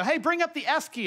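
high, mock call out the window; a wife calling up from outside, lightly yelled; retold yell. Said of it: "she yelled out the window".

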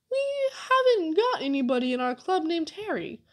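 high, tearful and faint-hearted; weak. A narrator's reading. Amos to the fellers about an unknown Harry, weakly. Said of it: "said Amos weakly".

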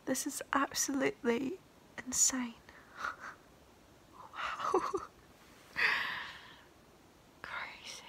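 soft whisper, stress on absolutely; awed whisper. Real, not acted. Overwhelmed by her own song, awed whisper.

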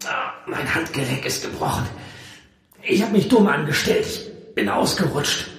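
high, strained voice through pain; pained, pressed confession. A narrator's reading. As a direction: groan it out, hurting. Injured hero groaning over broken wrist.

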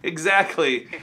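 laughing through the words. Real, not acted. Vlogger laughs at the caller's hacker joke.

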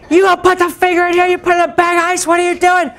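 high, loud scolding mother; exasperated mom. Retold or imitated. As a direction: scold him, baffled and upset. Mom scolding about the finger.

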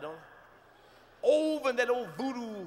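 ordinary talk, nothing marked

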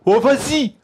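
put-on loud, high-pitched French teen street accent. Comic showing the audience how teens talk, mock teen accent. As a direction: throw it out in a teenager's accent. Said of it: "Vous avez vu cet accent d'ado".

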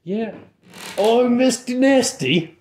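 put-on high, slow Cockney accent. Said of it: "the accent that I'm doing is super offensive".

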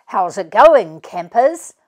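big cheerful dad hello; Dad greeting the kids at the tent, cheerful; hearty greeting. A narrator's reading. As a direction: boom it out like a jolly dad.

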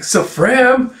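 high-pitched puzzled echo of the name; baffled. Acted. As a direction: repeat it in disbelief, pitch jumping up. Girl puzzled by wizard's name.

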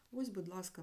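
ordinary talk, nothing marked

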